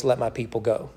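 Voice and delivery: lightly voiced, grand Moses commanding Pharaoh; a hint of a movie prophet, low and hushed; Charlton Heston impression. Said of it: "in his best Charlton Heston voice".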